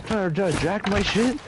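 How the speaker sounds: high, mocking taunt; gloating car owner. Car owner mocking the thief at gunpoint, high taunt. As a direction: crow over the thief on the ground. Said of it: "He's like".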